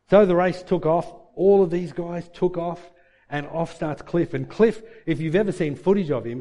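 ordinary talk, nothing marked

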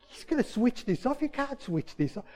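high, incredulous objection; acted-out alarm in a high voice; dismayed protest. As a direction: protest in disbelief, pitched well up. Acting out protest at pastor stopping.

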